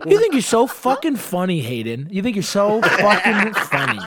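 high, mocking drunken taunt; hammed-up boozy sneer at your kid; nasty drunk mom. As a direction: imitate a mean drunk mother, needling. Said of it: "nasty drunk like that sometimes".

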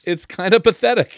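high and loud, laughing through the words. Real, not acted. Host joking with the guest about his own plain accent, laughing. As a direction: giggle at your own dull accent.

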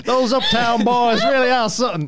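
high, loud hammed-up local; mock American local. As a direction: play a scandalised American onlooker. Riffs as a local onlooker.

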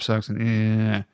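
drawn-out mock grumble; water-cooler complainer. Podcaster imitating the water-cooler whiner, mock miserable. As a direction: put on a glum, trailing groan. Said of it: "my life sucks and this job".

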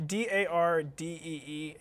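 D A R D E E spelled letter by letter, slowly. Shown as a demonstration. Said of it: "when I tell people how to pronounce it".

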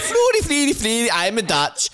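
very high, elfin fake-Dutch babble; hammed-up Dutch as a mythical elf language; mock Dutch. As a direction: tease with a squeaky pretend Dutch accent. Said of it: "it sounded like some mythical elf language".

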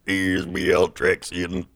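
low, slow, booming villain boss impression; boss voice line. Friend imitates a WoW raid boss, booming villain voice. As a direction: mimic the game boss's grand intro. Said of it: "He's like".

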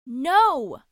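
sharp loud refusal. Acted. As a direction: shout to stop him. Cuts off dog trick.

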